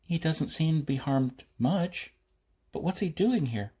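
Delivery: put-on a lighter, curious young voice; girl's voice. Girl voiced in narration.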